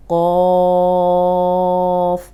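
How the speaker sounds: قوف drawn out, unrounded lips; heavy qaf. Shown as a demonstration. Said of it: "اب یہ موٹا حرف ہے".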